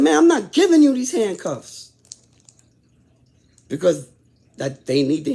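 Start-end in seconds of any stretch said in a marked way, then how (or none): 0.0-1.9 defiant retort. Retold or imitated. Refusing to hand back cuffs.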